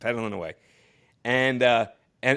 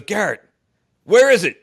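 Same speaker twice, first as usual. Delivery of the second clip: impatient call; 9 semitones higher, 11 dB louder than usual. Retold or imitated. Torre calling from cold strip.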